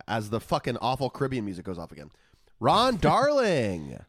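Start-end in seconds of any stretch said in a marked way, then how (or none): 2.6-4.0 drawn-out, raised-pitch name reveal; hyped player announcement. Pretend. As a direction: stretch the name out like a ballpark announcer.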